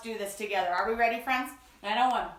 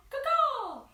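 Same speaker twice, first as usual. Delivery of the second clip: cawing raven; 12 semitones higher than usual. Acted. Raven call.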